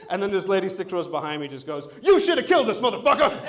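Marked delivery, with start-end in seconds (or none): 2.0-3.4 high, loud mock yell; hammed-up angry outburst; outraged heckler. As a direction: mimic a furious passenger shouting from behind. Same lady yells her outrage.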